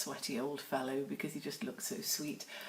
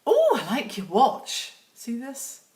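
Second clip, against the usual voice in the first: higher, louder mock mum; lightly voiced mum admiring; mum's voice; 7 semitones higher, 11 dB louder, slower than usual. Imitating mum admiring the watch. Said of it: "she went".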